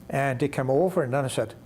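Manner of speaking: plain reading voice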